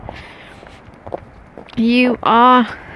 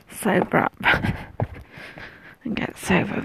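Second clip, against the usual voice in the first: whispering; 3 semitones lower, 7 dB quieter than usual. Real, not acted. Whispering past houses.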